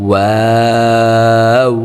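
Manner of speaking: ওয়াও drawn out slowly with fully rounded lips; rounded waw. Shown as a demonstration. Teacher showing students a round-lipped ওয়াও. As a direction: round the lips hard and chant ওয়াও long. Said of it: "যত গোল করবেন ঠোঁটগুলোকে".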